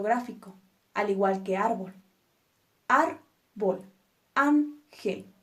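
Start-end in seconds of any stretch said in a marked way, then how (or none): 2.9-3.8 "Árbol" in two pieces, stress on ÁR; stressed first syllable. Shown as a demonstration. Teacher showing where the accent goes, "Árbol" split with ÁR stressed. 4.4-5.3 "ángel" split, higher stressed ÁN, then gel; stressed first syllable. Shown as a demonstration. Teacher showing where the accent goes, "ángel" split with ÁN stressed.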